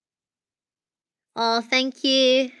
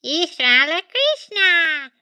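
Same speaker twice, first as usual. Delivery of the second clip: high, slow, loud alien squeal; Stitch alien shout; 9 semitones higher, 7 dB louder, much slower than usual. Retold or imitated. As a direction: stretch it out like a gleeful little alien yelling. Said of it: "so he goes like this".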